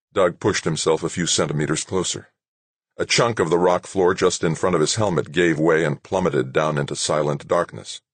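ordinary delivery

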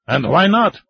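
high, loud and why not; defiant challenge. Acted. Old man to the mining chief, loud challenge. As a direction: throw the question back.

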